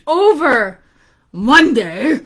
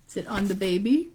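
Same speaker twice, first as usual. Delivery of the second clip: ordinary delivery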